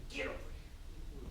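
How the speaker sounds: slow, intense hushed command; stern parent hissing at a kid; whisper yelling. Shown as a demonstration. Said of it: "whisper shouting thing".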